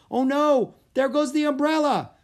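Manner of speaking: loud, high cry of dismay; alarmed cry. A narrator's reading. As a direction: call out as it blows away. Umbrella blown away in storm.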